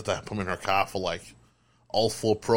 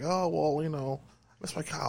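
mocking wife; 6 semitones higher, 7 dB quieter than usual. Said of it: "And she's like".

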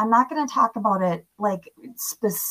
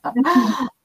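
laughs; 8 semitones higher, 3 dB louder than usual. Real, not acted. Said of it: "I'm very passionate about these topics".

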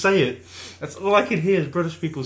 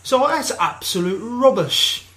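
slow, raised posh British put-on; 6 semitones higher, slower than usual. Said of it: "it'll be a posh British".

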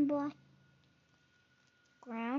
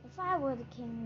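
usual speaking voice

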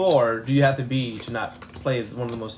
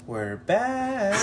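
higher, drawn-out ominous "We're back."; creepy serial killer; 8 semitones higher than usual. Shown as a demonstration. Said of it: "we should have said it more like".

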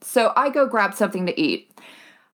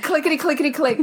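high, quick clickety chant; typing sounds; 6 semitones higher, faster than usual. Shown as a demonstration. Traveler showing how she types, high and quick. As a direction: rattle it off like fast typing. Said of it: "She also types when she says clickety click".

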